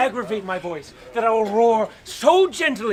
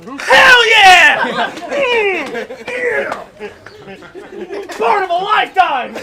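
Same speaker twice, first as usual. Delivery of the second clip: high, loud delighted whoops; excited cheering; 9 semitones higher, 8 dB louder than usual. Real, not acted. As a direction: cheer in pure glee. Thrilled at his casting.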